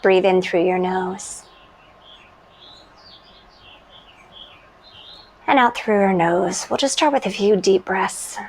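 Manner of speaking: calm, unhurried meditation voice with long pauses; guided meditation. Real, not acted.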